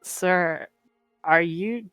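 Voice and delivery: ordinary delivery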